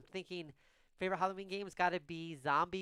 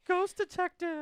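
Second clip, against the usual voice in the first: ghost voice; 14 semitones higher, 3 dB louder, much slower than usual. Acted. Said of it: "do the ghost voice all the time".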